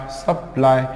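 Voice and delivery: सप्लाई in two parts, सप-लाई; break between syllables. Shown as a demonstration. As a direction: close off सप before saying लाई in सप्लाई. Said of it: "यहाँ पे break करना है".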